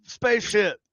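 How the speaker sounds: high, loud fairground announcer; carnival ride operator. Acted. Ride operator to riders over the PA, loud and high. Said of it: "he's talking into the fucking microphone".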